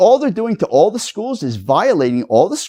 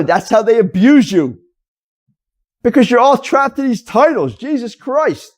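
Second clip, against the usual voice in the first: ordinary delivery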